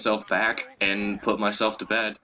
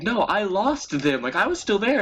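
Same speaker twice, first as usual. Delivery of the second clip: mock drunk protest; 12 semitones higher than usual. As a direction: play your drunk self insisting, pitched way up. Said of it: "the funniest part about that was, like".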